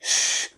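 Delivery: put-on श drawn out slowly like hushing children; long soft shushing श. Shown as a demonstration. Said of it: "जैसे हम बच्चों को बोलते हैं श".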